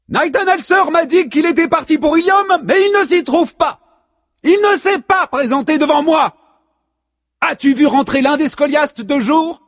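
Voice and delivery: high, loud commanding voice; imperious goddess. A narrator's reading. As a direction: demand answers like a goddess. La muse interroge Blix. (voix de déesse impérieuse)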